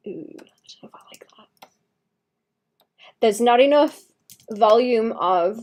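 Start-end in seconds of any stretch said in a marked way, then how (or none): 0.1-1.7 soft unsure whisper. Real, not acted. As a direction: whisper it, doubtful. Whispered self-talk.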